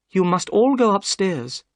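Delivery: lightly voiced Miss Morstan. A narrator's reading. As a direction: take charge calmly.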